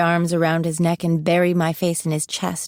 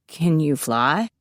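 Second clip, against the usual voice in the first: slow strangled lower voice; 3 semitones lower, slower than usual. Acted. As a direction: squeeze the words out, strangled. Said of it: "he asks in a strangled voice".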